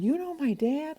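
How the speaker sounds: high, amazed question; surprised boy. Retold or imitated. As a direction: son amazed the stranger knows his dad, high and surprised. Boys surprised she knows dad.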